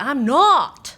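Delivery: "not" as a loud, rounded "nawt", Pennsylvania style; Pennsylvania accent. Shown as a demonstration. Imitating nephews from Pennsylvania, loud accented "not". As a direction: exaggerate the Pennsylvania vowel in "not". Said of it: "They say".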